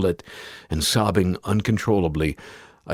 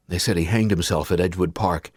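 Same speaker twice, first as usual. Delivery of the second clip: plain reading voice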